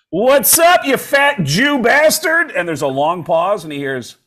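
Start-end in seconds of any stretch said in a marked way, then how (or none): 0.1-2.5 high, loud, slow jokey yell; mock shouted insult. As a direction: bellow the greeting like a buddy. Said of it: "And John goes".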